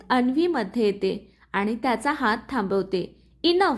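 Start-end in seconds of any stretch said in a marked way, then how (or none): 3.4-3.8 higher, slightly raised one-word outburst; mild shout. A narrator's reading. Anvi steps between the fighting men, mild shout. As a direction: cut in with an understated shout. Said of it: "ती ओरडते".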